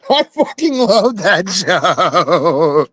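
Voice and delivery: gleeful high laughing yes; excited yes. Real, not acted. Friend gushing over a favorite comedy show, laughing.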